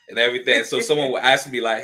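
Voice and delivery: normal talking voice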